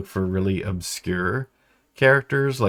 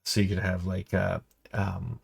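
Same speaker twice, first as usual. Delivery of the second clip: normal talking voice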